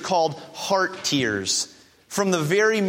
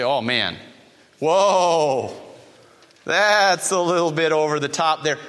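lightly acted disapproving bystander; mock taken aback; 4 semitones lower than usual. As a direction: react like a put-off onlooker, stretching the whoa. Acting out an onlooker's shocked reaction.

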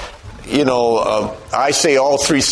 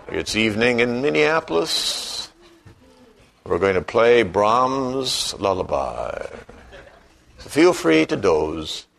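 public radio host; 4 semitones lower, 4 dB quieter than usual. Pretend. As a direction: lull the listener like an evening radio host. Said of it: "I've gone to my public radio voice right now".

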